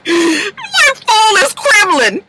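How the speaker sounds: high, laughing and slow; laughing correction. Real, not acted. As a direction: correct her through laughter.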